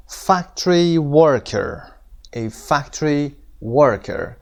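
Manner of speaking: very slow "factory worker", FAC and WOR stressed; stress on first syllables. Shown as a demonstration. Teacher models "factory worker" for students, first syllables stressed. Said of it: "قسمت اولش".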